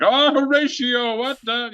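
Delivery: high, slow, overblown Shakespeare-style voice; mock a pompous actor declaiming; hammy stage actor. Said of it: "seeing people be like".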